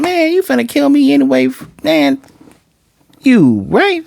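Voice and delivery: high-pitched, worked-up objection; exasperated protest. Retold or imitated. Telling the devil off as the victim would, exasperated.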